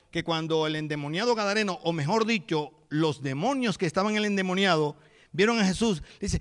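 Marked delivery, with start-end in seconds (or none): none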